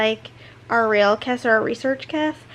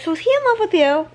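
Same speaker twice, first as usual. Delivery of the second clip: quick, higher nosy question; eager gossip; 6 semitones higher, 3 dB louder, faster than usual. Retold or imitated. Donna pulling Emma aside, quick and nosy. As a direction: rush it out, bursting to know. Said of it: "Donna ... is like".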